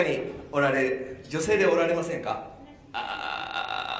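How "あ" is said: very low croak; froglike vocal fry. Shown as a demonstration. Teacher seeking women who can do it, froglike creaky croak. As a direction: creak like a frog on a long "ah". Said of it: "こういうカエルみたいな".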